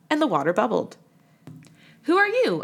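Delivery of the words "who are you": a startled high "Who are you?"; high, startled question. Acted. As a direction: Amos asks the face in the water, startled. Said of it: "asked Amos".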